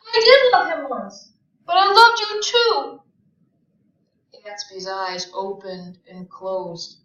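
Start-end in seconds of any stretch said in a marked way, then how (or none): 0.1-3.0 high, loud tearful confession; sobbing. A narrator's reading. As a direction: confess through the sobs, much higher and louder. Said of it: "She began to sob".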